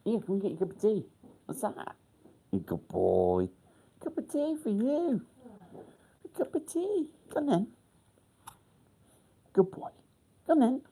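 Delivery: very high, fast cooing pet talk; talking to the dog. Real, not acted. Man coaxing his dog in for tea, high cooing pet voice.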